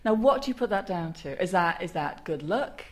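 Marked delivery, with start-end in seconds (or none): none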